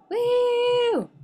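high loud cheer; victory woo. Real, not acted. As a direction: cheer the win. Celebrating time gained.

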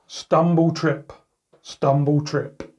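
ordinary delivery

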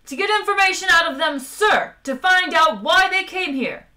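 high, loud, rapid protest; fast, high-pitched frustration; exasperated officer. A narrator's reading. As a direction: shout the obvious at a dim superior. Frustrated officer argues with captain.

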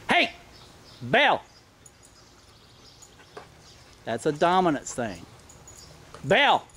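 short sharp calls at the dog, pitch jumping up. Real, not acted. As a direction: snap the dog out of it with a firm call. Correcting mounting dog.

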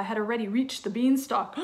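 plain reading voice

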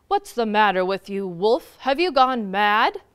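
loud, low bear voice; low, booming questioning. A narrator's reading. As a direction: demand it as an indignant bear. Bear scolding the wolf.

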